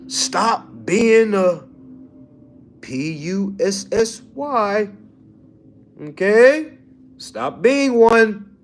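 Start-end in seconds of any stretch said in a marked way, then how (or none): 2.8-4.9 slow, letter by letter; spelled out. Shown as a demonstration. Coach spelling a rude word to clients, slow and deliberate. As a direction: spell each letter out slowly.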